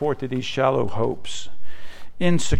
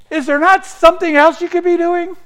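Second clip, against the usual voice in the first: high, louder exasperated complaint; exasperated whine; 9 dB louder than usual. Retold or imitated. Preacher voicing a fed-up complaint, high whine.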